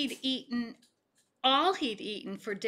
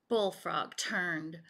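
ordinary delivery

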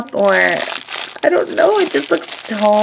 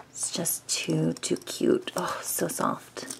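whispering; 4 semitones lower, 13 dB quieter, slower than usual. Real, not acted. Squishy review, whispering close.

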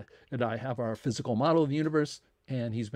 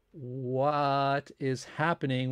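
very slow, stretched-out question; drawn-out bewilderment; much slower than usual. Retold or imitated. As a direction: imitate a baffled student, dragging the words out. Acted student's baffled question to professor.

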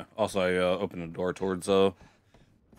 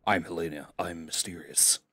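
slow, low, quiet mock mystery; lightly hammed secretive heroine; mysterious Helena; 8 semitones lower, 8 dB quieter than usual. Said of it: "that joke will still be funny".

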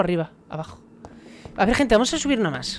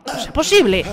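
loud exasperated yelling at game; frustrated shout; 8 semitones higher, 4 dB louder than usual. Real, not acted. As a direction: shout in disbelief at the screen. Enemigo le ataca en juego. (grito frustrado)

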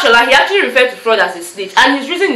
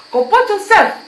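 high-pitched, exasperated telling-off; playful high-voiced reprimand; mock scolding; 10 semitones higher than usual. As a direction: scold an overexcited friend, pitch jumping up. Mock scolding of excited housemate.

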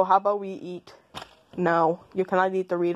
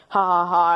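mock laugh; 4 dB louder than usual. Mocking the other voice.

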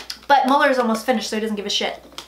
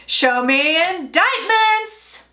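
slow, drawn-out host call; game show host; 4 semitones higher, much slower than usual. Pretend. Playing Family Feud host for the cards, drawn out slowly. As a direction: stretch it like a game show host revealing the board. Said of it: "It's just like Family Feud".

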